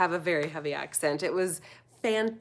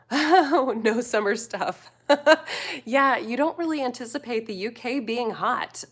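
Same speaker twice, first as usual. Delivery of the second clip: laughing as she reads, louder and higher; laughter spilling into the words; amused laughing; 5 semitones higher, 5 dB louder than usual. Real, not acted. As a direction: chuckle through a reply to a viewer. Amused by a viewer's comment.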